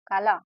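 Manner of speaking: काला with extra weight on its second syllable ला; stress on ला. Shown as a demonstration. Teacher repeating the stress example, ला drawn out in काला. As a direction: stretch the ला at the end of काला. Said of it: "ला पे ज्यादा".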